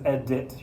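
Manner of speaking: "edit" with a full hard D, ed-DIT; overpronounced edit. Shown as a demonstration. Teacher showing what nobody says, overarticulated D. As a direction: split "edit" and hit the D firmly. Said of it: "not really fully pronouncing the D".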